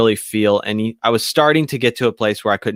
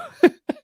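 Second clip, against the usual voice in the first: high delighted squeal of laughter; real giggle, pitched way up. Real, not acted. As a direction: squeal with amusement. Laughs about church hands.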